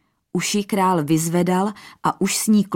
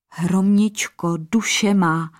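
plain reading voice

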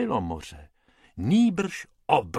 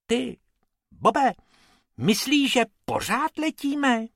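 high, timid question; frightened little rabbit voice; scared rabbit; 12 semitones higher than usual. A narrator's reading. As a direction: ask it nervously, voice up high. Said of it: "Bob ustrašeně vykoukl".

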